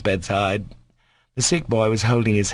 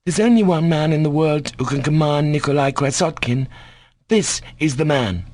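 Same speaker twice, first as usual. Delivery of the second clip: put-on high, proud boy's voice; defiant schoolboy; 7 semitones higher than usual. A narrator's reading. Schoolboy declares who alone can command him, defiantly.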